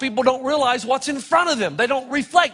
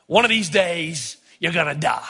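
imitating the old pastor; 7 semitones lower than usual. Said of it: "I wish I could say it like him".

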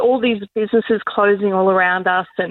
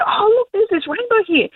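excited pointing out; 11 semitones higher than usual. Retold or imitated. Retold pointing out rainbow.

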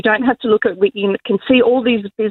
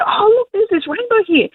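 much higher, eager look-at-this; excited pointing out; 12 semitones higher than usual. Retold or imitated. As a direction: call a friend over to see it. Showing the pastor the rainbow.

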